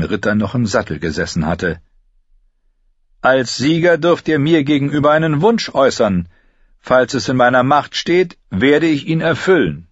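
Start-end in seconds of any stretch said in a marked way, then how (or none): 3.2-9.9 higher, louder regal voice; king addressing the field; young king. A narrator's reading. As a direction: praise the victor before the crowd. King Renly voiced in the story.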